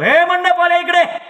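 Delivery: loud, very high, drawn-out holler; calling out. Retold or imitated. Father hailing the tent decorator, hollered high and long. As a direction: hail someone from across the venue. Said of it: "मंडपवाल्याला हाक मारतो".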